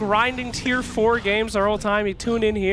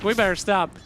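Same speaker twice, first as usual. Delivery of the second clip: ordinary talk, nothing marked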